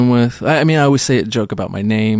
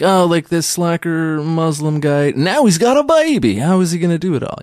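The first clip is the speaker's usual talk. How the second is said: mock astonishment; 7 semitones higher than usual. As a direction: pitch soaring in mock amazement. Voicing a reaction to his character.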